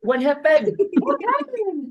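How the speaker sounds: high-pitched, urgent mock panic; loud, high acted reaction to a scream; acted alarm. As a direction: call out in hammed-up alarm. Acting out panicked reply to scream.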